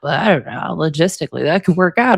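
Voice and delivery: a hint of a shrugging, noncommittal father; mimicking Rod. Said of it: "And Rod was like".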